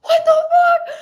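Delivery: very high, loud squeal of disbelief; shrieked, over-the-top amazement; excited shriek. Retold or imitated. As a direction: act out a stoked, laughing outburst. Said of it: "I was, like, so excited".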